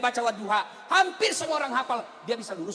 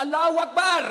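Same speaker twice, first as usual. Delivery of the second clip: loud, higher prayer call; mock takbir; 5 semitones higher, 6 dB louder than usual. Youth rescuing the stuck imam, loud mock takbir. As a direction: belt it out to cut the prayer short. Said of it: "anak muda lebih kreatif lagi".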